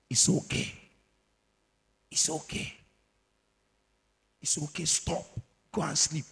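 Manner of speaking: hushed, half-whispered coaxing; phone's gentle voice. Pretend. The phone speaking to the user, hushed coaxing. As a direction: coax like a voice telling you to rest. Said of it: "Some of you have heard this voice I'm saying".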